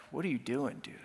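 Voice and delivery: low, quiet disappointed question; softly unimpressed, dropping low; mild disappointment. Acted. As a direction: let down, said under the breath. God voiced as disappointed with speaker.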